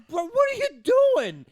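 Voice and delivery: high, loud incredulous Bro; yelling at a teammate gone rogue; mock disbelief. Fans mocking Kyrie, loud disbelief.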